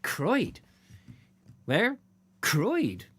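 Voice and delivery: playful two-voice exchange, pitch jumping up on questions; puzzled locals. Pretend. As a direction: act out baffled country folk. Acted dialogue of puzzled locals.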